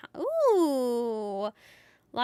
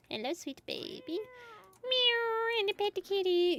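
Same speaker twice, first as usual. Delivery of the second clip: put-on cat baby talk; 9 semitones higher than usual.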